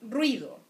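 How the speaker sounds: ruido glided into one syllable, rwi-do. Shown as a demonstration. Teacher to students, showing ruido as one syllable. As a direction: run the u and i together. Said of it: "in one syllable".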